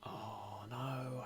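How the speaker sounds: ordinary delivery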